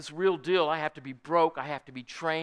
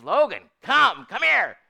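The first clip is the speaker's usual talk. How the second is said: raised, high call across a pasture; calling a horse; 8 semitones higher, 12 dB louder, slower than usual. Retold or imitated. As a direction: imitate an owner hollering for a horse. Vicky calls horse in pasture.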